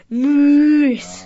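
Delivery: loud, drawn-out moose bellow; moose call. Acted. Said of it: "do a moose impression".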